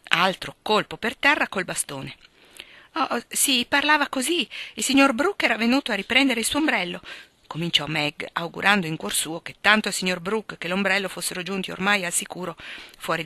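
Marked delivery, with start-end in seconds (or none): 2.9-7.0 higher, nervous excuse; lightly voiced embarrassed Meg; flustered girl, covering up. A narrator's reading. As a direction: make up an excuse quickly.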